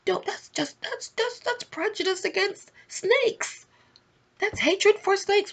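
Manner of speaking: high, sugary false-innocent voice, pitched well up; mock snake voice. The snake pretending it is harmless, high mock-innocent voice. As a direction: play the sly snake pleading innocence. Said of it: "the snake is saying".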